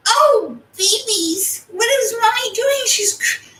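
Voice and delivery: very high, strained cooing; high-pitched pet talk. Real, not acted. Mommy struggles on the bed, high-pitched pet talk. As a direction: squeal it to the pets, voice straining high.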